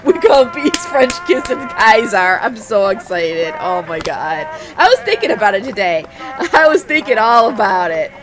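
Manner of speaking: loud, giddy shouting; hollering with glee at a friend; excited yelling. Real, not acted.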